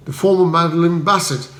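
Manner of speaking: ordinary delivery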